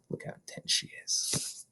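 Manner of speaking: hushed, awed aside; whisper. Real, not acted. Narrator whispers to children watching Matilda practice. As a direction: whisper in wonder.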